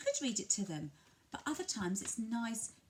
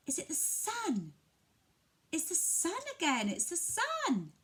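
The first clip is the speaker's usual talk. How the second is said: mock surprise; 9 semitones higher than usual. Shown as a demonstration. Parent showing delight at the sun for a toddler. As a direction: act delighted at the sun again. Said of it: "give them a pause and see".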